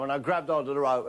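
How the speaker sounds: normal talking voice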